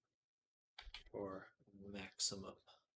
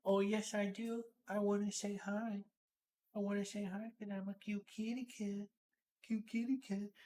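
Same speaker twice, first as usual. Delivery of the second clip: high, cutesy kitty voice; voicing the cat; 8 semitones higher than usual. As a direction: speak for the cat, all sweet and high. Voicing the cat's reply.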